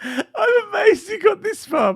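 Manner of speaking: high, slow, squeezed through laughter; laughing. Real, not acted. Host cracking up reading a comment, laughing. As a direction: struggle to read through real laughter.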